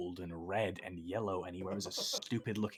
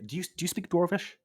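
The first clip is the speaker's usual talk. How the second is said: eager character voice; 9 semitones higher, 6 dB louder, faster than usual. Acted. Character asks dwarf.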